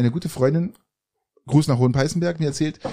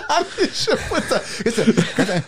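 high-pitched laughter over words; giggling through the words; laughing; 8 semitones higher than usual. Real, not acted. Laughing at the slip.

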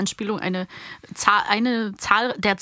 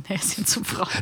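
softer, through giggles; laughing; 2 semitones lower, 6 dB quieter than usual. Real, not acted. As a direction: repeat it while laughing. Repeating line through laughter.

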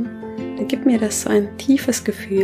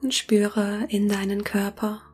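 soft spa whisper; 8 dB quieter than usual. Acted. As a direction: whisper gently, guiding them into calm. Leitet eine Meditation. (sanftes Meditationsflüstern)

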